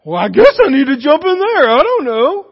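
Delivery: loud, high, hammed-up dithering; mock hesitancy. Rescuer watching a swimmer, mock helpless dithering. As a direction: play a useless, unsure rescuer. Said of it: "Or a rescuer trying to rescue a swimmer".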